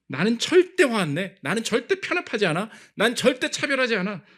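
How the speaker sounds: mock the hypocrite's proud claims; smug hypocrite. Preacher mocking a self-righteous hypocrite, loud and proud. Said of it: "위선이에요".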